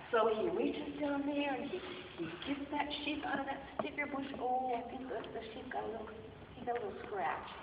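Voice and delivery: usual speaking voice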